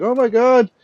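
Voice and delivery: high, loud frantic mother's cry; high, loud and desperate, begging for her kid back; retold screaming. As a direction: shriek it like a panicked mother. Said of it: "this woman's coming screaming".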